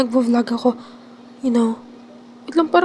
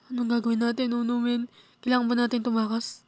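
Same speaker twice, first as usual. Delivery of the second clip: ordinary talk, nothing marked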